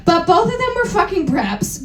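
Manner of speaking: usual speaking voice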